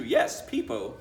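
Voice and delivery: delighted at the word; light teacher lilt; amused repeat. A narrator's reading.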